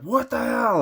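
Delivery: sudden high-pitched what the hell; angry outburst. Retold or imitated. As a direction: yelp it out in disbelief at the dice. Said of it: "I got mad".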